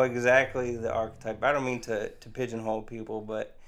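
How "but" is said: ordinary talk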